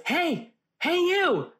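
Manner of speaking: high-pitched stranger calling for attention; drawn-out mock "hey, you!". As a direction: imitate someone hailing a stranger across the room. Stranger calling her across theater.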